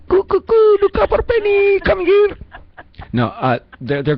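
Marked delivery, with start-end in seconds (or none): 0.1-2.4 very high, loud cooing call. Retold or imitated. Host mimicking the voicemail with a very high cooing call. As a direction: coo it way up high like a bird. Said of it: "we talk like we're from Punjab".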